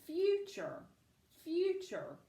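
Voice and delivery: "Future" with FU pitched high and hit harder; stress on first syllable. Shown as a demonstration. Teacher showing a pupil the accented syllable, stress on FU in "Future". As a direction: punch the first syllable of "Future", then drop. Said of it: "you accent that syllable".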